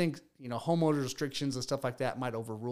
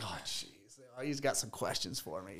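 low, muted put-upon grumble; imitated annoyance; 3 semitones lower, 7 dB quieter than usual. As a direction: mimic his reluctance, softer and lower at the start. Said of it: "he looked annoyed".

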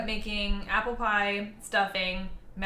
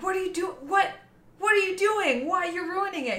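high, loud disbelieving cry; horrified at what he's doing; shocked; 9 semitones higher, 3 dB louder than usual. Retold or imitated. Shocked at ketchup in potatoes.

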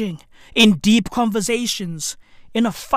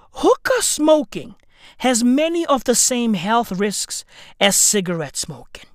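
usual speaking voice

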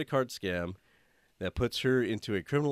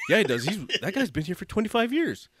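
loud, high laughing telling-off; scolding; 8 semitones higher, 4 dB louder than usual. Retold or imitated. Speaker scolding a coworker to show respect, loud laughing rebuke. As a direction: lay into him, half laughing.

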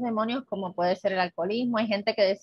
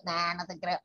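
dismissive; 2 semitones lower, 6 dB quieter than usual. Retold or imitated. Apostles doubting Magdalena's report, soft and dismissive. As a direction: wave her away, unimpressed.

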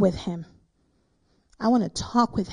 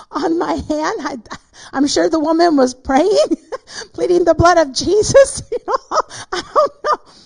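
real laughter, high and loud through the words; laughing; 6 semitones higher, 6 dB louder than usual. Real, not acted. Preacher recalling her burned hand to listeners, laughing. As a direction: crack up while telling it, voice climbing high.

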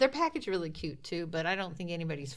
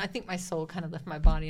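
ordinary talk, nothing marked

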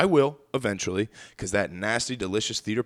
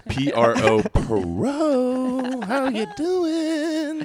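hammed-up announcer; 13 semitones higher, 3 dB louder, much slower than usual. Spelled out, then squeal.

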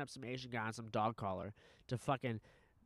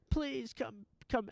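high-pitched imitated wail; mock screaming plea; 12 semitones higher, slower than usual. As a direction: briefly mimic a desperate scream, pitched way up. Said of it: "I wasn't screaming bloody murder".

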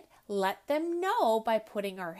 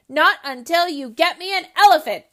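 put-on loud, higher spoilt-child demand; bratty demand; 6 semitones higher, 15 dB louder, faster than usual. Ellie demanding an elephant from her father, loud and bratty. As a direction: stamp your foot on it.

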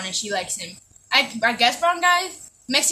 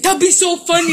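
gleeful; 5 semitones higher, 5 dB louder than usual. Retold or imitated. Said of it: "and I'm like".

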